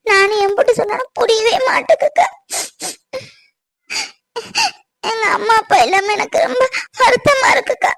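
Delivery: tearful, voice breaking while talking to her sister; crying. Acted. Bride crying to her sister over her absent parents. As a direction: sob through the words, pitch high and strained. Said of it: "அழாதம்மா".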